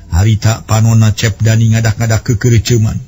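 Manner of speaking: ordinary talk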